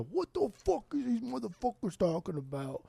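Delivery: high-pitched mock Boston accent. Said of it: "That's my Boston accent again".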